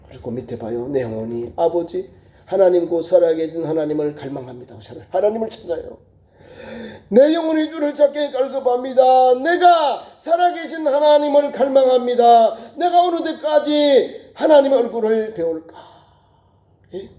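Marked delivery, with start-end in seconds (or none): 0.9-4.8 low, restrained scripture reading; subdued verse reading. Acted. Reading verse low before preaching it. 7.1-17.1 impassioned cry to God. Acted. Crying out the same verse.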